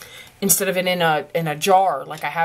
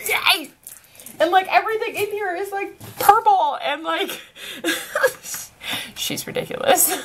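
high, fast, laughing gush; high giggling excitement; giggly gushing; 10 semitones higher than usual. Real, not acted. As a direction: laugh through delight. Gushing, laughing over gift.

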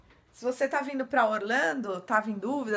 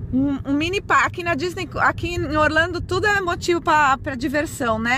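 excited; 5 semitones higher, 8 dB louder than usual. Real, not acted. Thrilled by the mini park.

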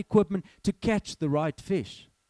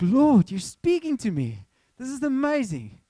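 excited amazement; 6 semitones higher, 4 dB louder than usual. Retold or imitated. Preacher reliving the plane moment, thrilled and higher. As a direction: let the wonder lift the voice up high.

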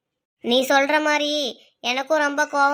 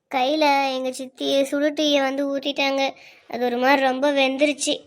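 ordinary talk

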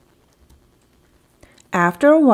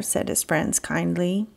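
usual speaking voice

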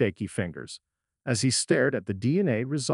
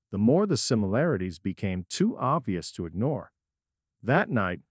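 ordinary delivery

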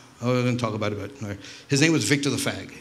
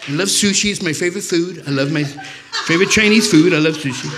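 Trump impression; 5 semitones higher than usual. Comic mimics Trump gushing over Koreans, hammed-up. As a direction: ham up Trump praising the Koreans. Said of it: "totally something Trump would have said".

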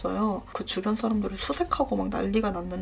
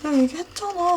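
much higher, frantic insisting; panicked protest; 8 semitones higher than usual. Retold or imitated. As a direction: plead with mom in a fright. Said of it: "완전 기겁을 해가지고".

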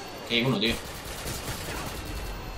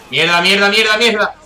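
louder, higher swearing; frantic cursing; 5 semitones higher, 5 dB louder than usual. Real, not acted. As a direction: curse in a panic. Panicked repeated curse.

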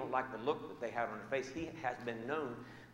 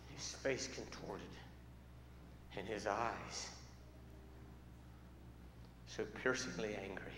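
hushed suspense; 5 semitones lower, 9 dB quieter than usual. Acted. Building suspense before the outburst.

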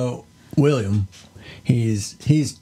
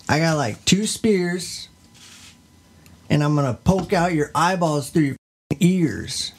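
higher, swaggering tough-guy boasts; gangsta goat; 4 semitones higher than usual. Pretend. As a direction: brag like a street thug making threats. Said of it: "He's a gangsta".

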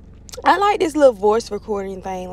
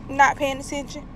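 normal talking voice

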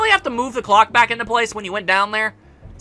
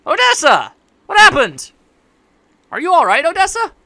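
louder, higher alarmed calls; alarmed Victor; 8 semitones higher, 6 dB louder than usual. Acted. Victor calling to the wounded Odessa, higher and louder. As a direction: shout for her, frightened she is hurt.

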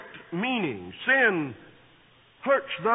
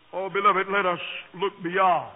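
ordinary talk, nothing marked

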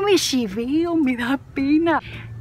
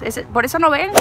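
ordinary delivery

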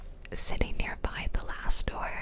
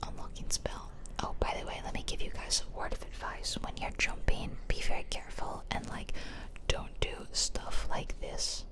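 whispered ASMR. Real, not acted. Player whispers to viewers about the speedrun, ASMR whisper. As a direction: whisper the commentary, no voice at all. Whispered game commentary throughout.

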